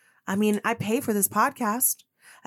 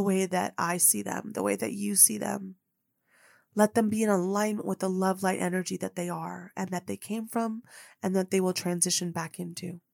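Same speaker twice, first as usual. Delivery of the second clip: softer, lower hushed prayer; a hushed heartfelt prayer to God; retold prayer; 5 semitones lower, 8 dB quieter than usual. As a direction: pray quietly, kneeling before dawn. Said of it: "And I said".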